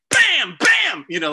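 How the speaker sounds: loud high bam bam like roots hitting metal; sharp loud mimicked thuds; imitated banging. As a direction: bang it out like debris striking the hull. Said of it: "roots going".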